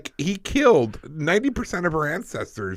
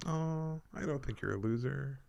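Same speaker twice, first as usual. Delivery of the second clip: much softer, gently acted sympathy; soft mock consoling; 2 semitones higher, 10 dB quieter than usual. Imagined stranger reacting to the loser tattoo, softly consoling. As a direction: play someone quietly reassuring. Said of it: "Someone's gonna be like".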